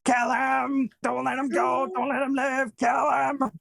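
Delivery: high shouting doll voice; Chucky's head yelling. Retold or imitated. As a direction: yell it high like a possessed doll. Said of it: "you heard Chucky's head going".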